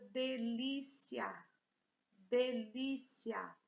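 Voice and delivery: delícia stretched as de-lí-cia; syllable by syllable. Shown as a demonstration. Teacher presenting a word to students, delícia in stretched syllables. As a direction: sound out delícia slowly and firmly. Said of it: "Três sílabas".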